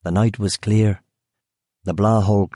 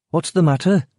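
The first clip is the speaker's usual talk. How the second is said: guard's voice; 8 semitones higher than usual. Acted. Guard over the intercom.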